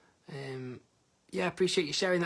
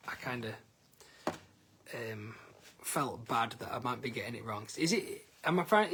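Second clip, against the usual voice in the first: normal talking voice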